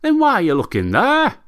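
can't believe the logic, ask it outright; baffled neighbour. Acted. Neighbour asks drunk Arthur why he searches there, loud and incredulous.

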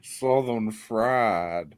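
very slow Southern drawl; Southern accent. Pretend. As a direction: draw it out in a mock Southern accent. Co-host mimics the Southern accent.

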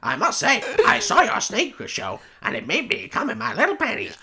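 high, squeaky nerd voice with a laugh; nerdy squeaky voice. Retold or imitated. Mocking the nerdy character.

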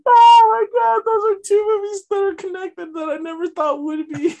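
high, loud excited outburst. Real, not acted. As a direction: squeal it in delight. Excited reaction after big laugh.

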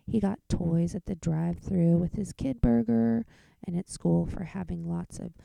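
ordinary delivery, nothing marked

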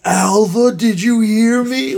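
high, loud imitated shout; Nick Cage yelling. Friend imitates the boss yelling at secretary Alva, sudden shout. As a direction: yell it like an unhinged boss losing it. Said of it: "all of a sudden he's yelling".

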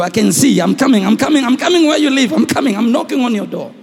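loud, driving preacher cry; preaching at full force. Acted. Preacher to congregation, loud full-force preaching. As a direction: thunder it out to the congregation. Preacher proclaiming God's coming.

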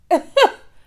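high, loud burst of laughter; disbelieving laugh. Real, not acted. Patient laughs at the doctor's late apology, loud.

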